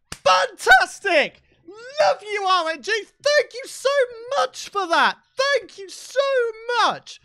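high, shouted sarcastic gratitude. Real, not acted. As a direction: yell bitter thanks at the game. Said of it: "Can you tell my sarcasm there".

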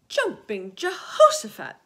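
high, very loud exclamation; whooping, thunderstruck outcry; astonished cry. Acted. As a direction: burst out in amazement. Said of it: "they cried".

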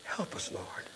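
hushed plea to God; whispered prayer. Retold or imitated. Preacher praying to God amid the noise, whispered. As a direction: breathe the prayer out under your breath. Desperate prayer during disruption.

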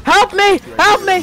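high frantic yells; panicked cries for help. Real, not acted. Player grabbed, calling out.

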